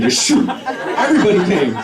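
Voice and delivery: ordinary delivery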